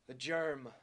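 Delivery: usual speaking voice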